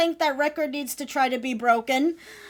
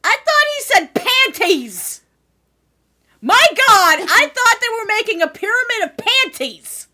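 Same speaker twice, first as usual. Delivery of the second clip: loud, high shocked outburst; shocked shout; 7 semitones higher, 10 dB louder than usual. Real, not acted. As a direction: blurt it out in disbelief. Shocked at misheard panties.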